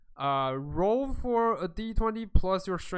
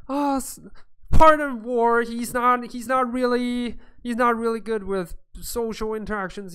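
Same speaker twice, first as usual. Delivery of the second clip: higher, louder apologetic interjection; apologetic Bree; 7 semitones higher, 6 dB louder than usual. Acted. Bree excuses Vor's poor social skills, high, loud apology. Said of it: "Bree's like".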